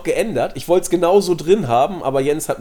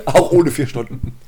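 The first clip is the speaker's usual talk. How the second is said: chuckling through the words; said while laughing; 4 dB louder, slower than usual. Real, not acted.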